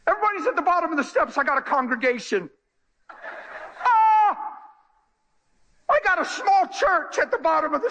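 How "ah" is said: excited shout. Real, not acted. Preacher excited by congregation.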